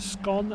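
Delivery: "scone" with a short o like gone. Shown as a demonstration. Streamer telling chat how he says it, "scone" rhyming with gone. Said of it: "as in gone".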